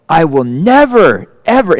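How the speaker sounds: slow, emphatic vow, leaning on never; boy swearing to himself, slow and emphatic; mock solemn vow. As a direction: swear it like a kid's oath. Twelve-year-old's vow.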